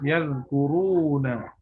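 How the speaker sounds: "yazkuruna" slowly, with a drawn-out "ruu"; long madd. Shown as a demonstration. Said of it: "Mat asli juga".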